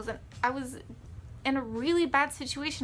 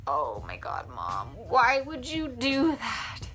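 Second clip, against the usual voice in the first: retold teenage exasperation, words stretched out; exasperated; slower than usual. Retold exasperated reaction to mom.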